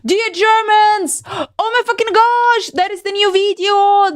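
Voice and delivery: high, thrilled shout-out; bursting with hype; excited. Real, not acted. Excited streamer greeting.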